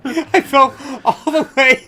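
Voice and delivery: excited laughing retelling. Real, not acted. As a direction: laugh through it, high. Laughing recounting fall.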